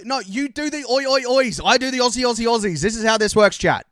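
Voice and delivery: high, loud, mock-frustrated insistence; exasperated correcting. Real, not acted. Streamer explains to chat who chants what, exasperated correcting.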